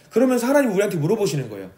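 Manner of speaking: normal talking voice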